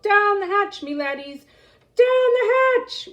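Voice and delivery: loud, high table-pounding cry; pirate yelling. A narrator's reading. Pirate captain yells at dinner, loud and high. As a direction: bang the table and holler. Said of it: "pounded his fists on the table and yelled".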